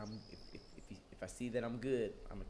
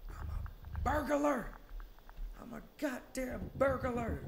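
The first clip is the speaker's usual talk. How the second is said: shrill comic shout, slow; high squeaky shout; 13 semitones higher, 3 dB louder, slower than usual. Pretend. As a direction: squeal it out cartoonishly.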